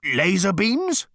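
slow, loud, high machine guess; hopeful machine guess; tank guessing. Acted.

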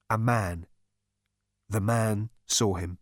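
ordinary delivery

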